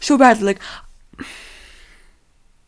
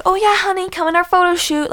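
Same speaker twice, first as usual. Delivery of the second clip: sweet invite; 8 semitones higher than usual. Retold or imitated. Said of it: "they're like".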